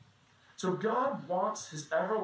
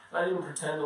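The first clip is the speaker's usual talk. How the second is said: ordinary delivery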